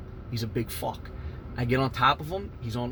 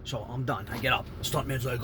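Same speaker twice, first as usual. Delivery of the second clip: normal talking voice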